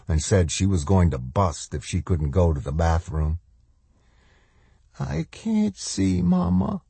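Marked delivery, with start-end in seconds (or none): 5.0-6.8 put-on high, slow little-girl voice; listless. A narrator's reading. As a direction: let it drift out weakly as a drained child. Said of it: "Swan said listlessly".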